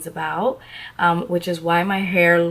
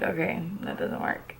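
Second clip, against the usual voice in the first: whisper; 11 dB quieter than usual. Retold or imitated. Inner thought, dismissive.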